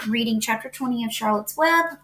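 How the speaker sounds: ordinary delivery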